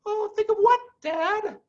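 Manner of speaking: put-on a child asking Mommy in fear; scared little fox. A narrator's reading. Small fox asks father.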